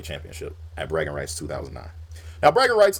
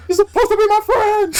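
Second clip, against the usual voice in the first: far higher, loud betrayed shriek; mock Batista wail; 11 dB louder than usual. Imitating Batista screaming at Rey before attacking. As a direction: screech it like a hurt friend. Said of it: "he was like".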